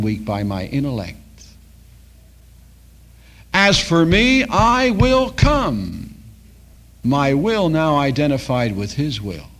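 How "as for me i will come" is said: loud, high and drawn out very slowly; proclaiming. Retold or imitated. Preacher voicing a convert's vow, loud and very slow. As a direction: ring out the vow, stretching each word. Quoting a man's surrender to God.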